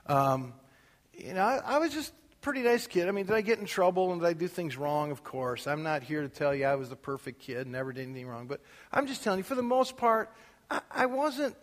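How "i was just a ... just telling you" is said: ordinary talk, nothing marked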